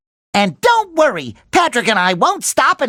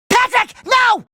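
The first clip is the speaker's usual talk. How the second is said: high, panicked shout; alarmed cry; 11 semitones higher than usual. Acted. Calling out to stop Patrick, alarmed. Stopping his friend in alarm.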